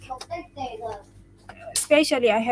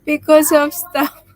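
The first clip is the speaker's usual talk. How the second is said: amused; 4 semitones higher, 6 dB louder than usual. Real, not acted. Amused by a chat message.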